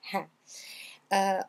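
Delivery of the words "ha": "ha" with its H fully heard; sounded H. Shown as a demonstration. Teacher repeats for learners that "ha" has an audible H. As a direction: breathe out the H at the start of "ha". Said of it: "il n'est pas muet".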